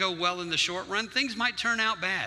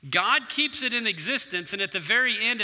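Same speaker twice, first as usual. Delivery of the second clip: ordinary talk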